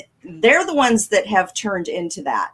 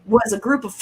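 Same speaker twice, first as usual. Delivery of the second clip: usual speaking voice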